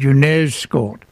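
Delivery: put-on drawn-out British accent, slowly; put-on British accent, stretching the words. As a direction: imitate a British embassy officer's accent. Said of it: "forgive the accent, please".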